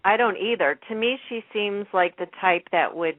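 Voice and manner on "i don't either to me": normal talking voice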